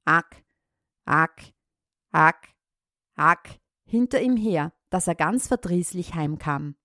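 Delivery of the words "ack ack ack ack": croaked frog calls, low. Acted. Frogs calling out at the pond, croaking. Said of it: "die Frösche riefen noch".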